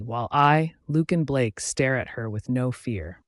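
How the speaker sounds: ordinary delivery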